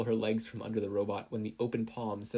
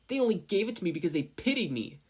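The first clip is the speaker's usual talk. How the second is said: high sharp bitter retort; bitter, resentful outburst; angry; 10 semitones higher, 4 dB louder than usual. A narrator's reading. Katsuki snaps at conscience.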